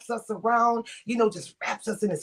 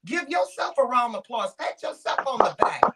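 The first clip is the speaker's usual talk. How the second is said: hyping up; 3 semitones higher, 9 dB louder than usual. Real, not acted. Hyping up his friend.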